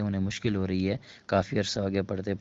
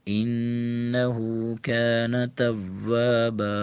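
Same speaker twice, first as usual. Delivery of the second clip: fluent Quran recitation; 2 semitones higher, much slower than usual. Shown as a demonstration. Teacher recites the full surah smoothly, slow melodic chant. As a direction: recite the whole surah with tajweed. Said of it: "روانگی کے ساتھ پڑھتے ہیں".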